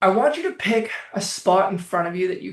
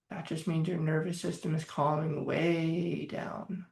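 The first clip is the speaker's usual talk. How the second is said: guided breathing; 3 semitones lower, 9 dB quieter than usual. Acted. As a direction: ease them down into calm.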